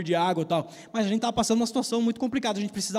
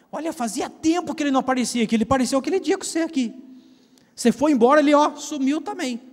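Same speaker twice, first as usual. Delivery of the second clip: put-on high, excited old-lady voice, pitched well up; grandmother; 7 semitones higher, 6 dB louder, faster than usual. Grandmother telling the preacher the man vanished, high and loud. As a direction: chatter it like the neighbour grandma, raised.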